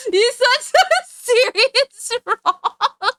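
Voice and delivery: slow, high, breaking into laughter; laughing through words. Real, not acted. Streamer cracking up at her own joke, laughing through words. Laughing slowly mid-sentence.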